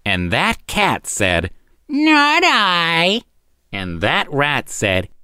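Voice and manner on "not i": high, snippy cat voice. A narrator's reading. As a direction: say it with smug glee. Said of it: "with snippy glee".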